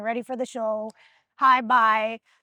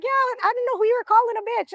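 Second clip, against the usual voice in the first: higher, fast mimic of a hurt teammate; imitating homegirl; 10 semitones higher, faster than usual. Homegirl explaining her upset, fast and higher. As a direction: rattle it off as the offended friend. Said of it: "She's like".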